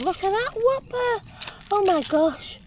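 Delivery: high, slow amazed squeal; astonished at a huge find; surprised. Real, not acted.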